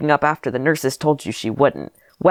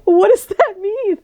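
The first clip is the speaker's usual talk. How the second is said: mock wail; 4 dB louder, slower than usual. Mock distress at own simile.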